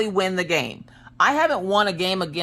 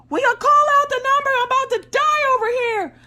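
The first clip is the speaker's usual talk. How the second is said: very high, squeaky, over-the-top whine; mock dying wail, way up high; whiny desperate plea; 4 dB louder than usual. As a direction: squeal it like you're about to collapse. Panicked mom begging kids mid-Twister.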